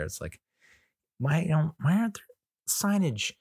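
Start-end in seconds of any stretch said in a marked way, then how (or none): 1.2-3.3 mock bafflement. Acted bafflement at missing signs.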